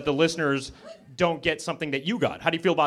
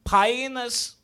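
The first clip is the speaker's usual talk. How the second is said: high-pitched fake Portuguese pronunciation of "Penis", like "PIE-nuhs"; mock Portuguese accent; 7 semitones higher than usual. Shown as a demonstration. Comic demonstrating Portuguese to the crowd, mock Portuguese accent. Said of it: "how I say penis in Portuguese".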